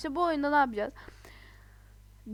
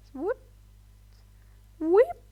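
high rising whip sound; jump sound effect; 8 semitones higher, 8 dB louder than usual. Pretend. Voicing her own jumps, a high whip noise. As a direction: make a playful whoosh noise.

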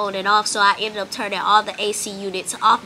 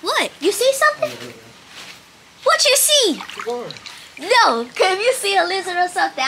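alarmed; 9 semitones higher, 3 dB louder than usual. Real, not acted. Partner may have spotted lizard.

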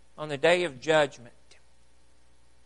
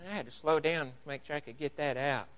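ordinary delivery

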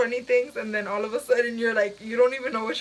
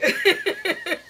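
high laugh; 3 dB louder than usual. Real, not acted. Laughing fit.

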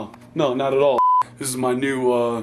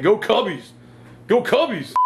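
excited cheer; 8 semitones higher, 4 dB louder than usual. Real, not acted. Cheering for Cubs.

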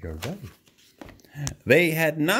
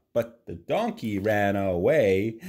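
ordinary delivery, nothing marked

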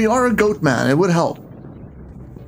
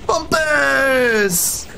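excited shout; 8 semitones higher than usual. Real, not acted. Yells at game enemy.

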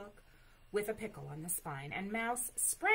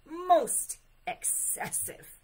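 high, loud, drawn-out disapproval; emphatic protest; 10 semitones higher, 11 dB louder, slower than usual. A narrator's reading. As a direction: stretch it out as a protesting bear. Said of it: "he protested".

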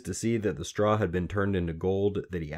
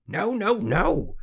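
high, loud string of noes; gleefully cross refusal; 14 semitones higher, 5 dB louder, slower than usual. A narrator's reading. Little man stamping at a wrong guess, worked up. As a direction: refuse like a hopping, stamping imp. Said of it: "he stamped and hopped around".

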